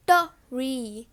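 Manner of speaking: "the re" as tuh, pause, ree; separated TR. Retold or imitated. Teacher imitating what some people do, separated TR. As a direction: split tree into two sounds, "the re". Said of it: "separate the words".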